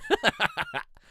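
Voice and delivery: high cackling laugh; loud burst of laughter. Real, not acted. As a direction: crack up loudly.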